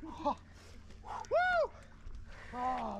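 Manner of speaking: ordinary talk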